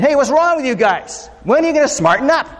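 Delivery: a hammed-up scolding king, high and fast. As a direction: nag like a fed-up ruler. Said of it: "a king that's saying".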